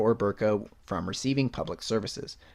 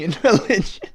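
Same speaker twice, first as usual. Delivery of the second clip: laughing; 10 semitones higher, 10 dB louder than usual. Real, not acted.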